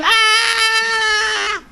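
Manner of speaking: high shrill shout of alarm; sudden high yelp of horror; monk's scream. Retold or imitated. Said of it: "he starts to scream".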